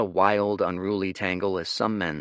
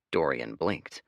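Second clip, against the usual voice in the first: usual speaking voice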